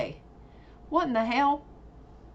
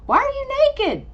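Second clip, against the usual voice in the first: very high, loud squeal of disbelief; shocked outburst; 7 dB louder than usual. Real, not acted. Player to a suddenly naked game character, shocked squeal.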